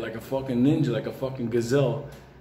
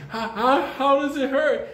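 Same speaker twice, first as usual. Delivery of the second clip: mock high questioning; 11 semitones higher, 3 dB louder, slower than usual. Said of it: "B D's like asking".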